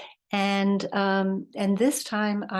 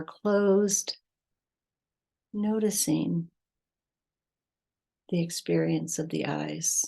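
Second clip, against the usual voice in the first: measured meditation voice with long pauses; guided meditation. Acted. Teacher leading listeners to breath and heart, guided meditation. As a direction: guide them unhurriedly, phrase by phrase, leaving space between.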